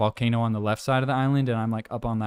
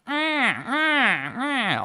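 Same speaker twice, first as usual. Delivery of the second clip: repeated high yowls, mimicking cats; fighting-cat imitation; 11 semitones higher, much slower than usual. Cats fighting face to face all day, imitated yowling. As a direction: imitate two cats yowling at each other. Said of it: "they fight ... face to face".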